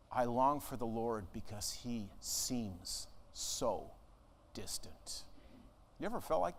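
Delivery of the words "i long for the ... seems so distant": slow, low and hushed, fading to a whisper; sorrowful longing. Acted. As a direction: let the longing sink away into a whisper. Voicing the psalmist's longing.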